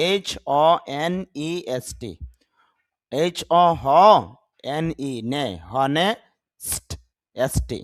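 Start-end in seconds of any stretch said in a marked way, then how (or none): none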